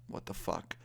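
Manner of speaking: low, soft muttered aside; hushed disbelief. Retold or imitated. Laying under the bed, muttering to himself in hushed disbelief. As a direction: say it under your breath, dropped low.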